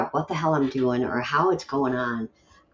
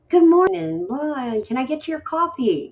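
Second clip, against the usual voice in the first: much higher, louder, sing-song chirpy greeting; mock perky morning person; 13 semitones higher, 4 dB louder than usual. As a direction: mimic an overly cheerful early riser. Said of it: "they are hard people that are jolly".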